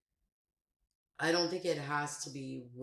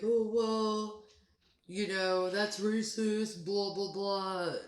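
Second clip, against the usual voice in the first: mocking quote; 5 semitones higher, 3 dB louder, slower than usual. Mocking people who call it racist.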